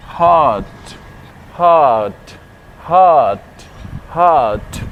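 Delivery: हार्ट with a long aa and no r, falling; drilled word. Shown as a demonstration. Teacher models हार्ट for learners, clear drill. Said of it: "next word है".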